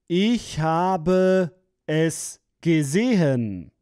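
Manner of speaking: usual speaking voice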